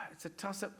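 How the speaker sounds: soft, sighed whisper; quiet whispered sigh. Real, not acted. Sighing dilemma.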